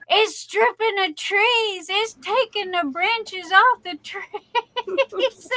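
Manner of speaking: quoting her mother. Retold or imitated. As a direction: relay mom's worried report, drawn out. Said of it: "Mutter kept going".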